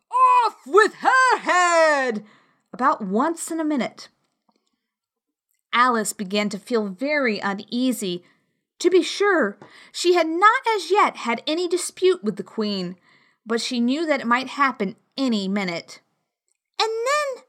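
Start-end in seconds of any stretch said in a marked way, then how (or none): 0.1-2.2 slow roaring royal command; furious shout. A narrator's reading. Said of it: "went stamping about and shouting". 16.8-17.4 put-on high, anxious little girl; lightly voiced worried girl; young girl voice. A narrator's reading. Alice's thoughts voiced.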